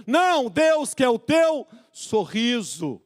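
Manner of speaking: high, slow, forceful proclamation; emphatic preaching. Real, not acted. Preacher correcting lamenting believers, emphatic high proclamation. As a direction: proclaim it, stretching the words out. Emphatic take, then softly repeated.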